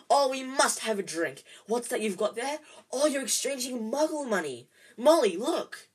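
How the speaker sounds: high, loud, gushing excitement; delighted. A narrator's reading. Mister Weasley marvels at Muggle money, delighted. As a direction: gush like an overjoyed enthusiast.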